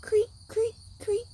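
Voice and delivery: very high squeaky creak; cricket creak. Retold or imitated. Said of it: "The crickets go".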